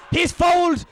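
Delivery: sharp high yell of protest; shouted appeal. Real, not acted. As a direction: cry out the foul. Commentator yells at a foul.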